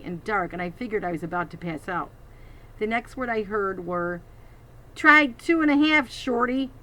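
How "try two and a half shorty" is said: louder old pirate voice, a hint of gravel; lightly voiced old pirate; old gravelly voice. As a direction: growl it with weary authority. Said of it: "too old and gravelly".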